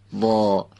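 وا held long with a heavy, backed vowel; back-of-mouth sound. Shown as a demonstration. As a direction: send وا toward the back of the mouth. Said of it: "باید بدیم عقب دهان".

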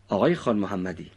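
ordinary delivery

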